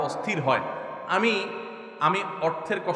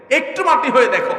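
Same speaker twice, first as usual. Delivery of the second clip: impassioned plea; 8 semitones higher, 7 dB louder than usual. Real, not acted. Repeated line louder.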